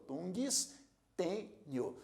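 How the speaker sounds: "Tungstênio" slowly split as tungs, tê, nio; syllable by syllable. Shown as a demonstration. Teacher repeating the division for learners, "Tungstênio" in slow separate syllables. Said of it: "se agregam à sílaba anterior".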